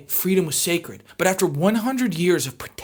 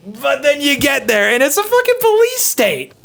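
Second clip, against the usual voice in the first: outraged exclaim; 7 semitones higher, 5 dB louder than usual. Real, not acted. Outraged reveal.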